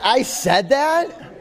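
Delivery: high, loud, slow and laughing in disbelief; "I said that?" stretched out high and loud through a laugh, rising at the end; incredulous laughing disbelief. Retold or imitated. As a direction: squeal it through laughter, as if you can't believe you said that.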